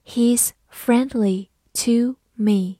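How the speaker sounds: each word slow and separate; slow reading. Shown as a demonstration. Teacher reads the example for learners to follow, slow reading. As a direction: model it slowly, pausing between words. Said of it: "慢慢来读".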